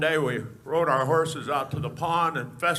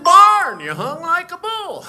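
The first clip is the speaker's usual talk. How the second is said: Thelma Lou voice; 12 semitones higher, 12 dB louder, slower than usual. Retold or imitated. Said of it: "She said".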